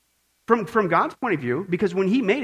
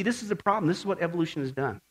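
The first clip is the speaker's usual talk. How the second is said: usual speaking voice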